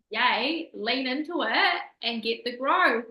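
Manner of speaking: an upbeat yay, pushed out loud; excited cheer. Retold or imitated. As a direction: cheer on the chance to grow. Cheering herself on about triggers.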